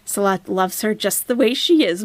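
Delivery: ordinary delivery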